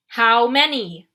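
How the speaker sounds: slow, loud, commanding demand; a general demanding an answer. Acted. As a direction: drag out each word like an order. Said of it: "the general demanded".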